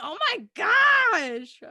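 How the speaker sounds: high, loud, long-drawn squeal; gushing delight. Real, not acted. Fan seeing how good they look for the wedding, gushing squeal.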